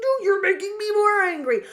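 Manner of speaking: hammed-up furious objection; mock outrage at being called cute; exasperated protest. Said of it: "she's like".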